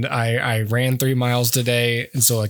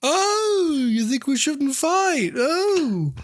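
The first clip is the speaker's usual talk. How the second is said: 1 [name]; 13 semitones higher, much slower than usual. Retold or imitated. Voicing [name] mocking [name].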